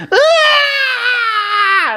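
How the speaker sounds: high shrieking scream; mock pained shriek; imitated shriek. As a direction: scream like a broken finger. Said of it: "all of a sudden I hear this".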